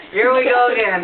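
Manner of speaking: high, loud laughter through the words; laughing hard. Real, not acted. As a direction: crack up while talking. Laughing fit mid-story.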